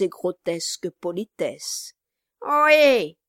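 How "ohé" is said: loud high hail from a window; shout. A narrator's reading. The dwarf hails the passing carriage, loud shout. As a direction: call out to the carriage, full voice. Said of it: "cria-t-il".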